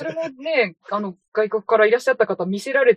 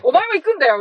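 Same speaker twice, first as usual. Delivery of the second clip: higher, louder mock shout; mock telling off; 8 semitones higher, 6 dB louder than usual. Retelling how the director snaps at his younger brother, mock shout. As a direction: throw the order at him in a hammed-up yell.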